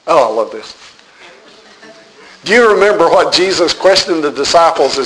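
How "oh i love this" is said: ordinary talk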